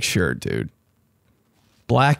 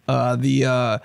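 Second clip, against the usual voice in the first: normal talking voice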